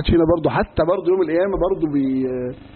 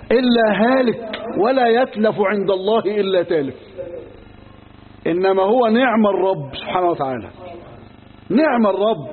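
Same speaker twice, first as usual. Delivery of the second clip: full-force preaching; 3 semitones higher, slower than usual. Acted. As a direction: proclaim each line like a warning from the pulpit. Preaching climax, slow and loud. (خطابة وعظية قوية وبطيئة)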